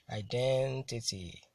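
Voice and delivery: "identity" stretched slowly, with DEN punched and the ending falling; very slow iDENtity, weight on the second syllable; stress on DEN. Shown as a demonstration. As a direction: model "identity" for a class, long stressed DEN. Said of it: "I T Y are usually stressed".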